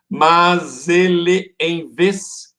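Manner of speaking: "Mas ele em vez" very slow, syllable by syllable; syllable-count demo. Shown as a demonstration. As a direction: stretch out each beat of "Mas ele em vez". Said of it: "quatro sílabas".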